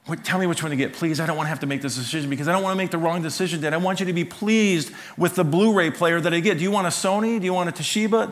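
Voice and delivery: put-on high, fast, anxious begging from a nervous teenage son; pleading son. Son phoning Dad from Best Buy, begging him to choose the player, high and pleading. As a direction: beg Dad to decide for you, voice climbing.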